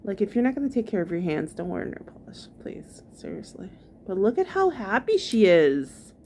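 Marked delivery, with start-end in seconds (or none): 1.5-3.7 hushed aside, barely voiced; whispering. Real, not acted. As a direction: drop to a whisper for the dig. Aside about her nail polish.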